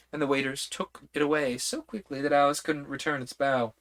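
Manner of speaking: plain reading voice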